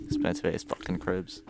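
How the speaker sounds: usual speaking voice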